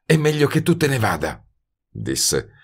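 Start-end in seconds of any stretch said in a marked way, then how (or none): 0.1-1.4 higher female voice, worried; Betty's voice. A narrator's reading. (voce femminile più acuta, preoccupata)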